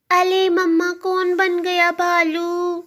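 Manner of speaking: put-on high, slow lisping toddler voice; little child. Daughter asks mamma who became a bear, high child voice. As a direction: pipe up like a curious small child.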